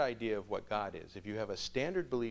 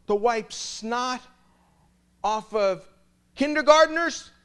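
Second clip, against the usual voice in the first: high, loud slow rant; irate father; 12 semitones higher, 12 dB louder, slower than usual. Retold or imitated. Furious father ranting at the priest about tuition. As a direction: spit out each word in outrage.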